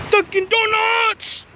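high, slow, drawn-out shout; excited exclaim. Acted. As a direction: stretch it out up high. Repeats the words, drawn out and high.

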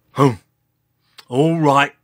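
loud, grudging grunt. A narrator's reading. Old Triceratops lets the newcomer stay, grunting. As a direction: grunt out a reluctant agreement. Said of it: "grunted George".

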